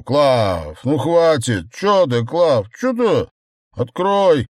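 put-on high, loud drunk pleading at a door; drunken calling out. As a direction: call her, wheedling and drunk. Drunk man calls wife at door. (пьяный крик под дверью)

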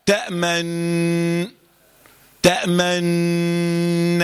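تأمن held very slowly on the nun; very slow demo. Shown as a demonstration. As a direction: drag تأمن out to show the nun's exit. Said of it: "لما أنا خرجت من النون". (مد تأمن ببطء شديد)

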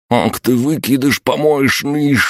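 high furious yell. A narrator's reading. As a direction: scream the insult. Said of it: "проорал Сидоровец".

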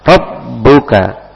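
Rabbuka slow with the bb held; pressed shadda. Shown as a demonstration. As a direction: hold the doubled b in Rabbuka. (rabbuka dibaca lambat dengan tasydid ditekan)

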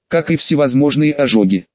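ordinary delivery

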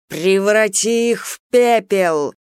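drawn-out fiery command; bright soaring fiery voice. Acted. Phoenix urges burning.

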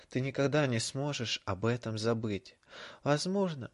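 ordinary delivery

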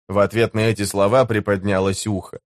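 ordinary delivery, nothing marked

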